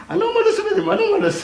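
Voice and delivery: very high, loud squawk; comic high-pitched indignation; shrill outrage. Acted. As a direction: squeal it in scandalised disbelief. Shocked at the creepy caller.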